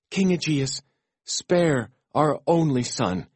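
slow, high pleading; begging. A narrator's reading. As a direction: beg the king to spare him. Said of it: "his mother and father begged".

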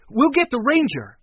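high, loud and urgent. A narrator's reading. Boy leading children away for help, urgent. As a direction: push them to hurry.

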